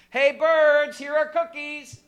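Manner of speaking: high, loud call to the birds; storybook shout, drawn high. A narrator's reading. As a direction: holler it out as if across a yard. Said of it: "He shouted in a loud".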